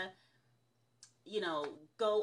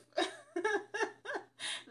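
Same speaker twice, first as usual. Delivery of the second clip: high rising giggle; laugh; 11 semitones higher than usual. Real, not acted. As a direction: giggle at the proof. Laughs at her timeline proof.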